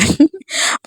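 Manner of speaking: brief high giggle; small self-amused laugh; light chuckle. Real, not acted. Vlogger joking about her family's cooking, light giggle.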